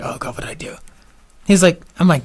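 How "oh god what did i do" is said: frightened breathy whisper under his breath; scared whisper. Retold or imitated. Driver at the window, pulled over by a cop, scared whisper. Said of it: "of course, I'm scared at this point".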